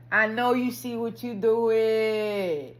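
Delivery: loud, stretched mock telling-off; mock self-scolding. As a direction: drag it out like you caught yourself. Acted scolding of himself.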